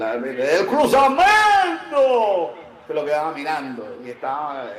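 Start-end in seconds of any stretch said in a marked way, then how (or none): 0.0-2.5 long-drawn, swelling loud cry; imitated commentator's call. Retelling a narrator at the stadium, drawn-out mock call. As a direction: stretch it out like a match commentator. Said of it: "el narrador decía".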